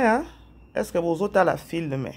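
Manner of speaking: ordinary talk, nothing marked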